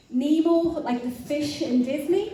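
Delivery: sheepish retold question. Prayer leader offers an odd vision to the woman, sheepishly. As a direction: offer it as if it sounds absurd.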